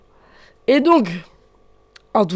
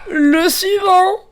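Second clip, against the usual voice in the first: calling out; 9 semitones higher, 5 dB louder, much slower than usual. Real, not acted. Calling the next player.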